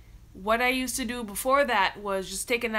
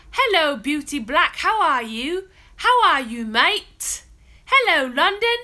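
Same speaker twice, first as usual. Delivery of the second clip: loud, high, hammed-up British accent; bad British accent; 6 semitones higher, 11 dB louder than usual. Greeting a live viewer from London, bad British accent. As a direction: greet them in an exaggerated, badly faked British accent. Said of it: "Why does my accent sound so bad".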